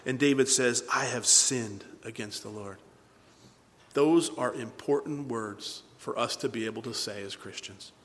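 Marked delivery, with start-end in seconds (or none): 0.9-2.8 slow, low, softened admission; solemn confession. Acted. David admitting his sin to Nathan, solemn confession. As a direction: confess it quietly and slowly.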